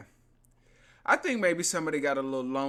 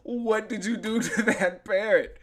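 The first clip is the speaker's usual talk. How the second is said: mock police; 5 semitones higher, 5 dB louder, slower than usual. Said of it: "And they was like".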